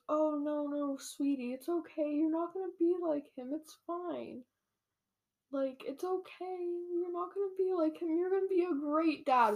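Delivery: high, soft cooing voice; imitating a tender, high-pitched comforter; acted reassurance. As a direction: soothe a worried sweetheart in a high voice. Acting her reassuring the worried dad.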